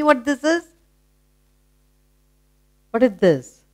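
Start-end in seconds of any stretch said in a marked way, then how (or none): none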